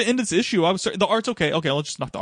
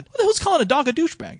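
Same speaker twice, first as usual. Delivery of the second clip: high-pitched imitation of a scolding parent; mock parental yell; 3 dB louder than usual. Parents scolding daughter for a naughty word, mock yelling. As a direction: mimic an outraged yell, voice jumping way up. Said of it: "yell at her for saying a naughty word".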